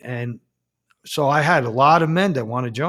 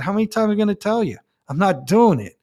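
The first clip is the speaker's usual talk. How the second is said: fast, higher-pitched exasperated snap; exasperated refusal; 6 semitones higher, faster than usual. Retold or imitated. Priest refusing the bishop again, exasperated and fast. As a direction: throw the refusal back at him.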